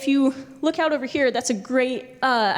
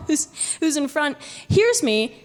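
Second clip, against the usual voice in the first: ordinary talk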